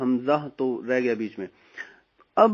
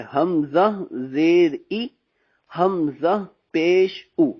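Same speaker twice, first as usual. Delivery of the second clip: drawn-out drill recitation; slow spelling drill; much slower than usual. Shown as a demonstration. Teacher slowly spells for students. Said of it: "spelling demo".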